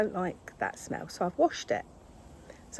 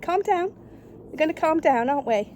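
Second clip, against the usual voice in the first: higher, louder coaxing to a pet; 8 semitones higher, 7 dB louder than usual. Real, not acted. Owner coaxing her angry cat, higher and louder. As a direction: coax the cat to calm down.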